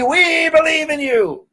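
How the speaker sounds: high, slow shouted crowd chant; crowd shouting. Retold or imitated. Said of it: "crowd answers".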